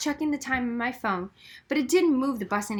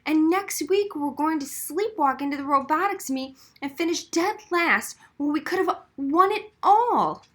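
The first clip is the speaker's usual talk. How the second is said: high, louder, urgent plea; impassioned plea; 6 semitones higher, 4 dB louder than usual. Acted. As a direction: pour it all out to Oz. Chloe pleads her case.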